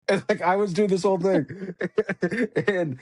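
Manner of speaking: amused, high and quick through laughter; laughing. Real, not acted. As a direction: laugh your way through the line. Laughs while retelling his antics.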